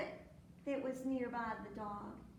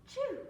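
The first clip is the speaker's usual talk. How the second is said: gasp, then a high, loud "choo"; mimed sneeze; 12 semitones higher, 6 dB louder than usual. A narrator's reading. Storyteller as the rooster catching cold, mimed high sneeze. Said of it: "and the sneeze".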